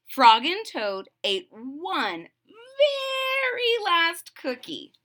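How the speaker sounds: ordinary delivery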